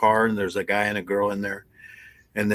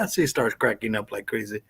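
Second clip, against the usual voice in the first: ordinary delivery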